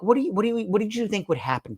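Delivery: high, stumbling disbelief; incredulous. Acted. Host questioning Casey's botched escape, incredulous. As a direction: pitch it up, baffled by his foolishness.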